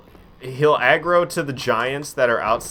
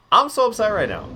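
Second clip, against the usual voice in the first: much higher, squeaky mock complaint; mock upset; 10 semitones higher than usual. Streamer repeating his complaint to chat, in a hammed-up high voice. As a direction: pitch it way up like a whiny joke. Repeats line after dying.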